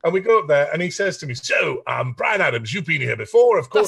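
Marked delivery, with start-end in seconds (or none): 1.3-3.9 booming TV interviewer with put-on accent; Quest impression. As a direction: impersonate a red-carpet finance reporter grilling a guest. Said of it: "That's a great accent".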